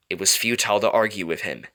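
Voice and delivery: ordinary delivery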